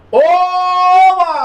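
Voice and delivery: high, loud, bright cheer; excited cheer. Shown as a demonstration. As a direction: really celebrate it. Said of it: "não é assim não". (oba empolgado, alto e agudo)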